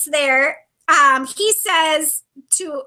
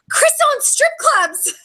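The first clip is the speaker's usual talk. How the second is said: high, slow, distraught blurt; upset blurting; 10 semitones higher than usual. Retold or imitated. Telling the doctor about Chris, high and distraught. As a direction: squeak it out like you're near tears. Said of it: "The first thing I said is".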